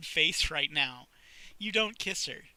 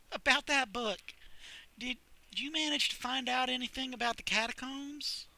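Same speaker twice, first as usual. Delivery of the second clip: put-on higher curious girl voice; lightly voiced librarian; 8 semitones higher than usual. Sally asking Chuck about the catacombs, higher. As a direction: ask eagerly.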